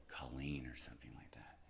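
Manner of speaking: whispered, throwaway; whispered aside. Real, not acted. Joking to listeners about mispronouncing, whispered. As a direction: let it slip out in a whisper.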